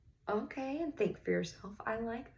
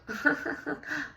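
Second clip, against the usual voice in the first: a short taunting laugh at a rival; mocking kid laugh; 8 semitones higher than usual. A smug kid laughing at the racers. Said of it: "One of the kids laughed".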